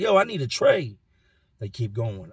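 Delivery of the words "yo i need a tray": calling out. Retold or imitated. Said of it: "I'm like".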